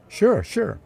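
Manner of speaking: high, swooping put-on agreement; smitten eager man. A narrator's reading. Smitten man answers her demand.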